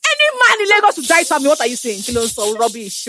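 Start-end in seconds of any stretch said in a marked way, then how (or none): 0.1-1.5 high, laughing mock boast. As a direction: brag it like a diva.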